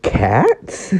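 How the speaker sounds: high, amused teasing question. Acted. Ochako teasing Deku about cats, suppressed amusement. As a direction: hold back a laugh while teasing. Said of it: "tried to hide in her laughter".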